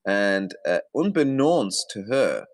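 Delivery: usual speaking voice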